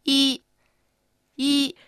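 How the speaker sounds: い held long on one high pitch; high level tone. Shown as a demonstration. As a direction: sustain い high and even, like a beep. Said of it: "ちょっと高めに言ってみてください". (「い」を高く平らに伸ばす)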